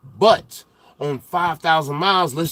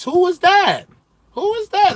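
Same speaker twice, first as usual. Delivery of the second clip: much higher, squealing question; excited disbelief; 14 semitones higher, 4 dB louder than usual. Real, not acted. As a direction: demand a name while cracking up. Laughing demand after the roast.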